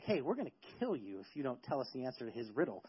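low, hushed mock threat; quiet menace, pitched well down; leaning on her. Friends pressing the wife for the answer, quietly threatening. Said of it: "they lean on her".

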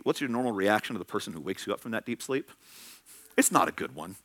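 ordinary talk, nothing marked

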